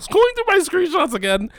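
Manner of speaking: high, loud laugh through words. Real, not acted. Laughing while scrolling his screenshots. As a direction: crack up mid-sentence.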